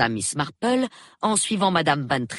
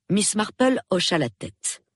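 ordinary delivery

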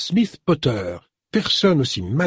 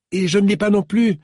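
lightly voiced young woman, pitched higher; a hint of a girlish phone voice, higher; young woman's voice; 8 semitones higher than usual. Young woman on the phone recalls Celia, lightly voiced young woman. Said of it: "déclara l'aimable jeune personne".

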